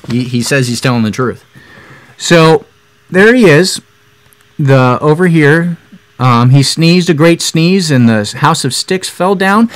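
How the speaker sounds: usual speaking voice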